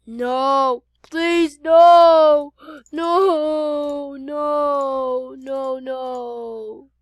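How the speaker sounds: wailed, drawn-out pleading; hammed-up plea for life; desperate begging. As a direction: cry out, then fade in despair. Victim begs for life.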